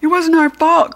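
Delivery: high, loud protest; pleading cry. A narrator's reading. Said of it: "cried Harold".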